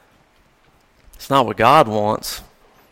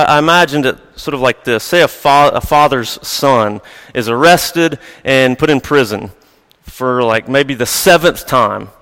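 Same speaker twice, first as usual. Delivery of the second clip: ordinary talk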